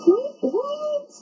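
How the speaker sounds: high, loud startled what; squeaky stunned double what; shocked outburst. Real, not acted. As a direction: blurt it out in total shock. Shocked reaction at game.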